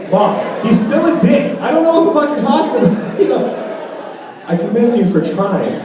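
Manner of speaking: high fast mock yell; exasperated yell. Son yelling at his mom about dad, mock outraged. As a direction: blurt it out in outrage.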